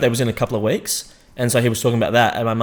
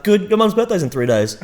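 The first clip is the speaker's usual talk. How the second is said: higher, eager retold Jordan voice; mimicking Jordan; 9 semitones higher than usual. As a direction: redo the quote with a lifted, keen pitch. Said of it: "sorry, I butchered that".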